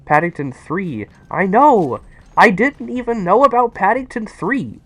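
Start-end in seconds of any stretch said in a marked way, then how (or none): 1.3-4.8 high, bright rush of excitement; excited. Real, not acted. Telling chat about Paddington Three, excited. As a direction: gush about the news, pitch up. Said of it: "I'm even more excited".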